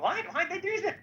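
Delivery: high mock whine, asking why; mock whiny why. Retelling his reaction to Eric on the jet, high mock whine. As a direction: squeak it out like a baffled kid. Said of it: "I was like".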